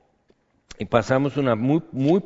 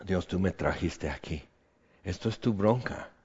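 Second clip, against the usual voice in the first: much softer, low, surrendering, ending in a whisper; hushed prayer; 4 semitones lower, 6 dB quieter than usual. Retold or imitated. Nehemiah praying to God in surrender, hushed and low. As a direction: hand it over to God quietly. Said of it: "Nehemías puede decir".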